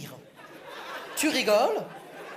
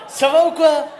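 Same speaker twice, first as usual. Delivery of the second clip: loud, raised greeting with a laugh; loud call-out; 4 semitones higher, 10 dB louder than usual. Acted. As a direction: shout hello to the crowd. Calling out to the audience.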